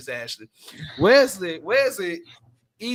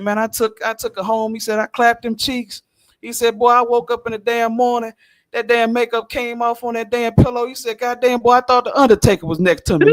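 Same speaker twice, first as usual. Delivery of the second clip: 1 building excited retelling; 7 semitones higher, 4 dB louder than usual. Retold or imitated. Retelling buddy's shocked morning story.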